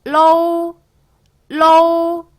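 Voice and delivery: 로우 high and loud, 오 gliding into 우; pinyin lou demo. Shown as a demonstration. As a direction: model 로우 firmly for the class. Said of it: "러오 시작".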